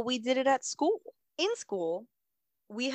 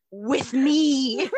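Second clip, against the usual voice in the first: laughing, louder and higher; 8 semitones higher, 7 dB louder than usual. Retold or imitated. Laughing while finishing the cheater joke to friends. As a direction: laugh through the words with me.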